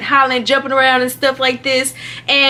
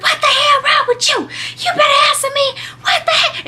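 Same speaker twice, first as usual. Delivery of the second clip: very high-pitched mock scolding; grandma going off. Grandma demands answers from screaming child, imitated going off. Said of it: "she was just going off".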